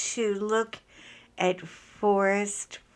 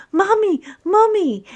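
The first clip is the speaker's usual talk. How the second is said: put-on high, loud kid calling for mommy; imitated child's yell; 10 semitones higher, 8 dB louder than usual. Mother imitating her son upstairs story, imitated child's yell. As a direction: yell out as a panicked little boy would. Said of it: "I hear David yelling".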